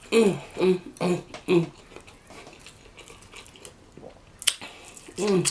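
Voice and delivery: chewing while humming; talking between bites; mouth full. Real, not acted. Seen 0: Bites sauce-dipped chicken, chews with full mouth while humming.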